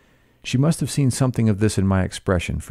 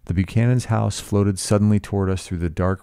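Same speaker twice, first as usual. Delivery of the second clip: ordinary delivery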